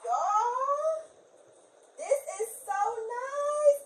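high, slow gushing with emotion; gushing makeover reveal. Real, not acted. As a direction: gush over it, almost tearing up.

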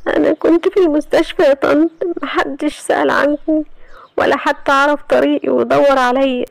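pleading through tears, slowing in the middle; crying. Acted. Iman tearfully telling her aunt nobody looked for her. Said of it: "وهي بتعيط".

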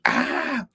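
high scream "Ah" done in a put-on voice; imitated scream. Retelling a viewer's message, imitated high scream. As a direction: mimic the ugly howl he wrote out. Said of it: "Dice así".

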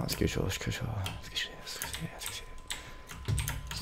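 hushed whisper, slowing to a crawl. Real, not acted. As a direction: whisper it so nobody hears. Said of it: "Jeg hysjer på noe som bråker".

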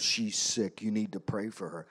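lightly imitated gruff biker, low; low, curt biker demand; a hint of the gruff biker. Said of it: "in his gruff voice".

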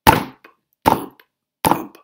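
very loud thumping sound effect; loud thumps. Acted. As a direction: thump it out with both heels. Both heels against wall.

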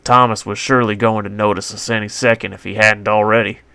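ordinary delivery

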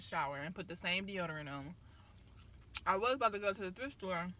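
talking while chewing; eating a snack; mouth full of food. Real, not acted. Seen 3: Holds nugget at mouth, bites around 4.2-4.7, then talks while chewing.

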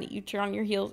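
ordinary delivery

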